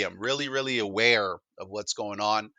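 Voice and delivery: normal talking voice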